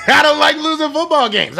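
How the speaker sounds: high, laughing restatement. Real, not acted. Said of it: "I'm sorry".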